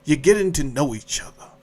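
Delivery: normal talking voice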